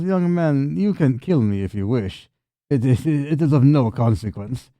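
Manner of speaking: put-on lilting old-woman voice, defiant; unyielding old wife. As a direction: stand firm as an old woman facing a threat. Game master voices Anna.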